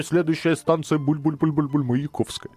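бульканье вместо слов, как диктор метро; пародия на булькающее объявление в вагоне; drinking from a fountain. Retold or imitated. (булькающий голос диктора метро)

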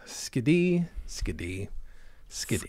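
quiet, sinking, deadpan repeat; subdued repetition. Retold or imitated. Host mulling over the silly catchphrase, fading quietly. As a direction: let it die away softly.

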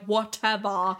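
exaggerated British vowels; mock his British accent on one word; Hardin. Showing how he talks in the movie, mock British accent. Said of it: "when he talks like this and he's like".

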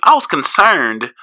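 high, loud, fired-up storytelling; worked-up retelling. Retold or imitated. Retelling to his cohost a frantic day of worry, high and loud.

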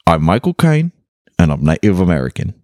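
rushed, faintly British Michael Caine line; a passing British accent, ending quick; British accent attempt. Friend says he had the British accent for a second. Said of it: "You had it, [name]".

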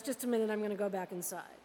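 quick, low, offhand murmur; soft, hurried and low, playing it cool; feigned casual. King to visitor, slipping back inside, feigned casual. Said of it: "tries to look casual and says".

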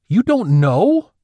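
high, loud, drawn-out disbelief; incredulous yelp. A narrator's reading. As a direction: yelp it slowly in sheer disbelief. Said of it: "practically yelped, sounding incredulous".

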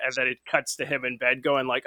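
ordinary delivery

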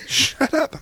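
high, sharp mock snap. Dad telling squabbling kids to shut up, mock snap. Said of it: "I'm like".

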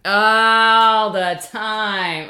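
very loud, drawn-out theatrical wail; over-the-top lament; mock-dramatic outcry. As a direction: stretch it out hugely, loud and hammy. Mock-dramatic answer to pun setup.

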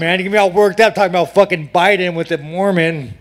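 ordinary talk

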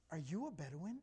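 low, soft, acted-out wonder; hushed awe. Companions to a newly arrived Bedouin, hushed awe. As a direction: ask it low, as if star-struck.

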